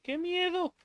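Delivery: high squeaky mock-scared cry, hammed up; mock fright. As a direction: pretend to be terrified, falsetto. Mock fear in horror game. (miedo fingido en falsete)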